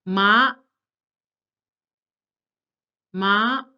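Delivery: "Ma" rising steadily, loud; second tone. Shown as a demonstration. Teacher reads for students to repeat, "Ma" rising. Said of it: "after I read each tone twice".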